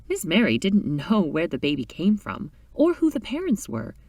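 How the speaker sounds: plain reading voice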